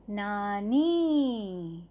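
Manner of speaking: नानी drawn out very slowly, each syllable clear; slow spelled-out word. Shown as a demonstration. Teacher showing the long i, slowly drawing out नानी. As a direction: stretch नानी out slowly for the class. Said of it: "तो बन जाएगा".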